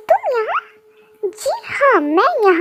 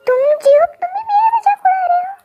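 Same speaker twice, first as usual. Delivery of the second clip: put-on whiny girl; 10 semitones higher than usual. Girl complains friend mocks her.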